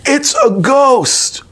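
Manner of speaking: high, slow cry of fright, retold; terrified scream. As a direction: mimic the disciples' frightened shout. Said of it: "They scream in terror".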